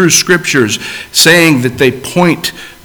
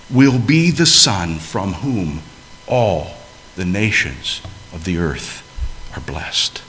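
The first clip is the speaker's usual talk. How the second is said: inner thoughts; 6 semitones lower, 5 dB quieter than usual. Retold or imitated. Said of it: "he thinks to himself".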